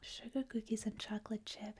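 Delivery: ordinary talk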